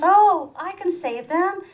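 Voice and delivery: high, slow saintly mother; mock the mother's noble sacrifice. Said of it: "my mother says".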